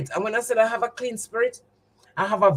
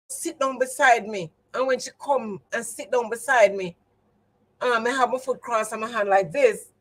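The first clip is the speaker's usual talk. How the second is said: ordinary talk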